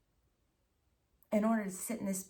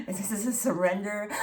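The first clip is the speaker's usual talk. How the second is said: laughing; 8 dB louder than usual. Real, not acted. Still laughing from before.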